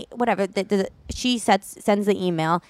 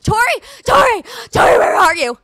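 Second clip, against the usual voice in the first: very high, loud frantic shouts; hammed-up panicked mother calling out; mock hysterical calling; 10 semitones higher, 4 dB louder than usual. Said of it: "I was hysterical".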